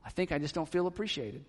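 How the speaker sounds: soft, subdued mock confession; quiet admission. Wife admitting what is behind her anger, softly. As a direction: let it out gently, voice dropped.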